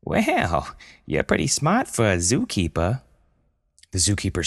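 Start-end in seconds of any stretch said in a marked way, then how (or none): 0.0-3.0 squeaky high cartoon voice; sassy high-pitched animal voice; high panda voice. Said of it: "In her own voice".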